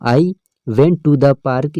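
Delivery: sentence read out slowly, word by word; slow reading aloud. Shown as a demonstration. Teacher reads the example sentence slowly to students. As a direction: dictate it slowly to the class. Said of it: "teacher reads example sentence".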